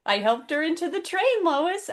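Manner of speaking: high-pitched pretend helpfulness; mock innocent boast. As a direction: play innocent, pitched well up. Joking as Clark boasting to Lois.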